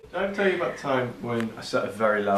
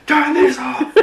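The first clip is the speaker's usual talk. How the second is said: loud high panicked shout; frantic shout to turn off the alarm; 11 dB louder, much slower than usual. Retold or imitated. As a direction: yell it over the alarm. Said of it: "I shouted out".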